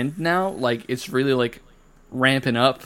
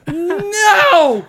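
very high, loud, drawn-out wail; mock-anguished cry; 8 dB louder than usual. Friend learns he made a reference, hammed-up wail. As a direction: wail a long no in hammed-up despair.

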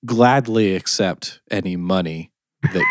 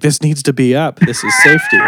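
insistent wife; 8 semitones higher, 4 dB louder than usual. Retold or imitated. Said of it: "my wife found it and was like".

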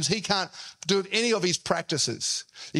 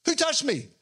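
sharp, higher-pitched demand; accusing tone; 5 semitones higher than usual. Shown as a demonstration. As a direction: demand to know who did it. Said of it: "I always read it with this tone".

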